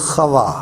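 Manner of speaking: خوا with a throaty Hebrew kh at the start; Hebrew pronunciation. Shown as a demonstration. Teacher gives viewers the Hebrew form خوا, throaty kh. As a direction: say خوا with a rasping kh, kha-wa. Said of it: "اس کا تلفظ خوا".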